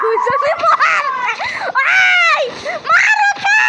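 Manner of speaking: high, loud battle yell; excited shout. Real, not acted. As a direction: scream at teammates to attack. Screaming during a fight in game. (উত্তেজিত চিৎকার)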